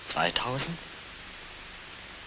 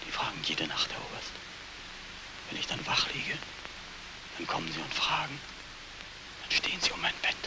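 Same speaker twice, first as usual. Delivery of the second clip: hushed haunted telling; 2 semitones lower, 7 dB quieter than usual. Acted. Veteran telling the colonel of nightly ghosts, hushed. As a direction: tell of the dead in the dark.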